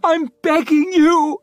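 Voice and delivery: high, loud, panicked plea for help; desperate squeak. A narrator's reading. Savine begging the big man on the barricade, desperate high squeak. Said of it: "her voice a desperate squeak".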